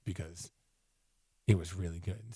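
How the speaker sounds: quiet, winded; out of breath. Real, not acted. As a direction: trail off breathless. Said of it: "I'm just out of breath".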